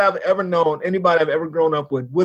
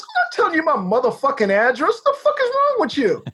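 retold indignation; 8 semitones higher than usual. Driver refusing the neighbor his address, indignant retold outburst. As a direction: pitch soaring into exasperated disbelief.

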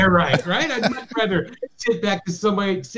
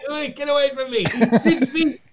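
mock alarmed passenger; 7 semitones higher than usual. Acting out a passenger quarrel.

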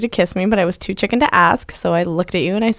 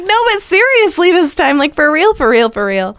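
pleading insistence; 9 semitones higher, 4 dB louder than usual. Retold or imitated. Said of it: "we were like".

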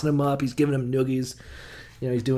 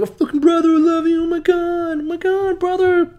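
much higher and louder, hammed-up blubbering joy; mock crying brother; 5 dB louder than usual. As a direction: gush like a sobbing long-lost brother. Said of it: "and he's crying".

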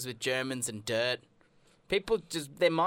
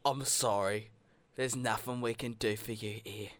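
put-on British accent; 4 semitones lower, 6 dB quieter than usual. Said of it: "didn't do good British accents".

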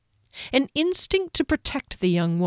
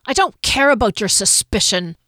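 mildly spat, louder angry retort; understated spitting anger; 3 semitones higher, 4 dB louder than usual. Acted. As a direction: bite the words out in rage. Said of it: "Hunter spat".

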